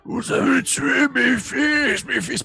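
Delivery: high, slow howling monster cry; creature shriek. Acted. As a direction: wail it like a grieving ghoul. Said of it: "il fait une espèce de".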